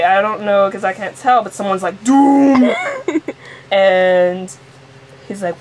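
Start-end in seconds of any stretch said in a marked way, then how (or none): 2.0-2.7 loud, drawn-out cry of doom; booming exclamation. Acted. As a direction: boom it out ominously, higher and long. Someone's booming exclamation in improvised story.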